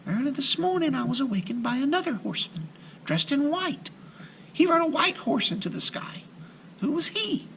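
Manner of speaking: put-on high timid girl voice; a shy child telling what she saw; little girl voice. A narrator's reading. Marusha voiced.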